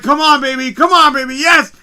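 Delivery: high, loud cheering; losing it. Retold or imitated. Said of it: "I'm literally, literally losing my shit".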